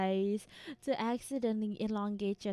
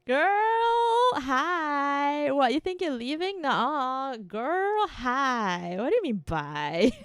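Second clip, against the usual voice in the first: sassy teasing; 4 semitones higher, 6 dB louder, slower than usual. Pretend. Teasing a leaving viewer.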